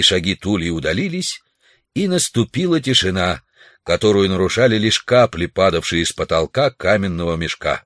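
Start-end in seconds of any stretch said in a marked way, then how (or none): none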